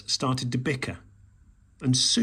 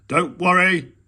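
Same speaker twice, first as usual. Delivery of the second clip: a louder reassuring shout; 5 semitones higher, 8 dB louder than usual. A narrator's reading. Badger to Mole up the tree, reassuring shout. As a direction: shout up to comfort him. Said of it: "shouted Badger".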